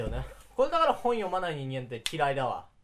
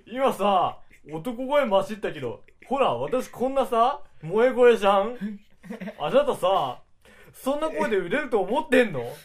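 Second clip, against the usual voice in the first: put-on higher, louder cute anime girl voice; put-on cute girl voice, pitched up; moe voice; 6 semitones higher, 9 dB louder than usual.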